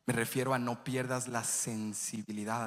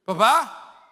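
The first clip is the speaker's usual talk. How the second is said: put-on a high, loud child's call; little boy calling dad; 7 semitones higher, 13 dB louder than usual. Little son in the garage calling his dad, high and loud. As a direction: call out like a small son looking for his father.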